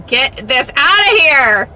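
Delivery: loud, slow, drawn-out order; imitating a mom shouting at messy rooms; retold shout. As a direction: belt it out slowly, word by word. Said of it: "I'm sure that I shouted".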